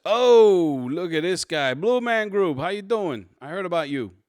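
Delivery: big, loud New York Italian greeting, pitched high; hammed-up New York Italian cop, high and loud. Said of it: "I went New York Italian on this one".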